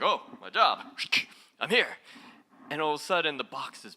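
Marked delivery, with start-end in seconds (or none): 0.0-1.9 louder, perky guard snapping to duty; mock the ego jumping to attention; eager ego voice. The ego reports for duty, a louder eager mock voice. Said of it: "the ego's like".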